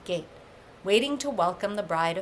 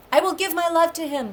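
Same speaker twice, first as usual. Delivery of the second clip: pleading cry; 9 semitones higher, 4 dB louder, faster than usual. Acted. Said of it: "cried out to Skirnir to spare her".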